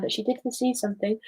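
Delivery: ordinary delivery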